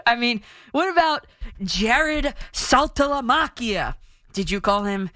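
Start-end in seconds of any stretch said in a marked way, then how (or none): 1.6-3.9 "Saltalamacchia" slow and raised, with a hard K: "mah-kee-ah"; Italian pronunciation. Shown as a demonstration. Host asking critics about a player's name, careful Italian pronunciation. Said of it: "You say them in Italian too".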